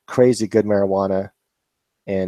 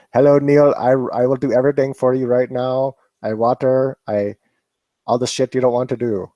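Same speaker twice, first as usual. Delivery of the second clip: mock Hindi accent; 3 semitones higher than usual. Imagined robot servant greeting Neil, in a mock Hindi accent. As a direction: play a cheerful robot helper with a put-on Hindi accent. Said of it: "my robot would have a, a Hindi accent".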